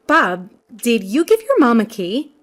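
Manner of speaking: ordinary delivery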